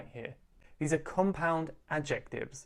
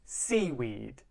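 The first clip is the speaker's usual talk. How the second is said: "Seaweed" with the beat on SEA; stress on first syllable; 3 semitones lower than usual. Shown as a demonstration. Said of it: "It's on the first syllable".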